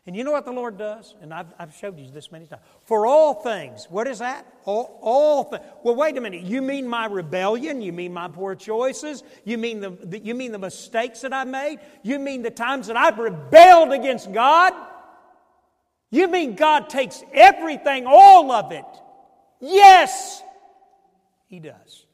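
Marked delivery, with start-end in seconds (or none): 2.8-18.8 loud, high, building preacher's cry; preaching at full force. Acted. Pastor shouting to the congregation, building fervour. 19.6-20.4 a high, loud ringing yes; triumphant shout. Acted. Pastor answering his own question, triumphant shout.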